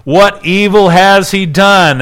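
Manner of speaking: mildly acted demand for a reason; protesting appeal. Pilate bargaining with the mob, protesting appeal. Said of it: "he's again bargaining with the mob".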